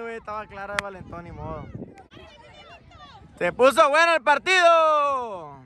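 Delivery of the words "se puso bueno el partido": high, slow, drawn-out call; excited exclamation. Real, not acted. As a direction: sing out delight at the game. Exclaims over the exciting match. (exclamación alargada de emoción)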